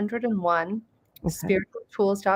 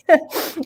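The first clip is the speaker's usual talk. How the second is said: short laugh; 8 semitones higher, 6 dB louder than usual. Real, not acted. Laughing at own story.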